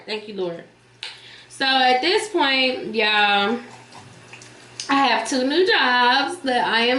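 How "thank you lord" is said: soft murmured thanks; grateful prayer under the breath; quiet. Real, not acted. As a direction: drop almost to a breath. Quiet thanks after loud one.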